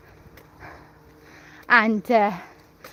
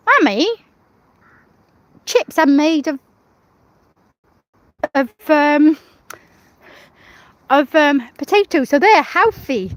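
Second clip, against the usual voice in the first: put-on higher, louder child-like quote; son's voice; 4 semitones higher, 5 dB louder than usual. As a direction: voice the little boy's eager line. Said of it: "he's got a thing with saying".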